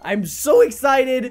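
slow, drawn-out showman's announcement; big crate-opening hype, hammed up; mock hype. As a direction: stretch every word, rising into excitement.

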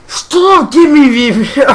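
very high, loud frantic yell; screaming. Real, not acted. Gamer shrieking at the game, very high and loud. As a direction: scream it in a panic.

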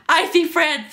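high-pitched, loud schoolkid taunt; jeering. Acted. Kids jeer at boy.

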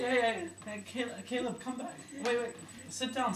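normal talking voice

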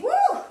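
high, loud cheerful whoop. Real, not acted. Coach whooping after the demo move, loud and high. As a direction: let out a big woo.

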